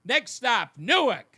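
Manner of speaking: projected conductor's shout; train conductor call. Acted. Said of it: "the train conductor said New".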